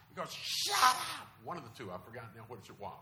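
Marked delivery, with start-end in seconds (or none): 0.3-1.3 very high, loud mock tantrum shriek; screaming kid. Preacher imitating boy in shopping cart at mom, screaming. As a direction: screech it like a bratty little boy. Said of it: "he's screaming and yelling at his mom".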